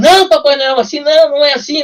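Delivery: high, loud mocking protest; mock high objection. Guys objecting retold.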